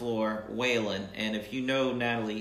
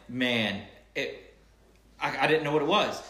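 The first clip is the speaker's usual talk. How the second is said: ordinary talk, nothing marked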